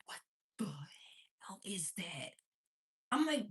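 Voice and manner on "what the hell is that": very soft whispered question; hushed bafflement. Retold or imitated. Mother baffled by a strange voice, hushed. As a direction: whisper it low, to yourself.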